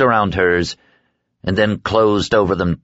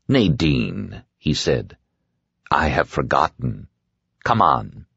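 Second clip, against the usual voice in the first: lower, quiet and unhurried; a lightly voiced sinister man; the dark man brushes off her question, low; dark man's voice; 6 semitones lower, 3 dB quieter than usual.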